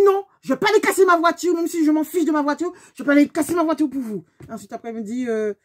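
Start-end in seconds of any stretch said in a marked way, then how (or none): 0.1-4.2 higher, loud indignant refusal; angry refusal. Retold or imitated. Refusing the other driver to damage her own car, indignant shout. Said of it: "j'ai dit".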